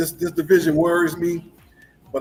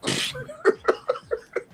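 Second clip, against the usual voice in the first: burst of laughter; 4 dB quieter than usual. Real, not acted.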